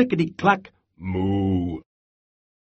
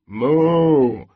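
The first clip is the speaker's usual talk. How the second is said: moo; 2 semitones higher, 4 dB louder than usual. Acted. Cows typing and mooing.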